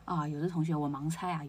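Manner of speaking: ordinary delivery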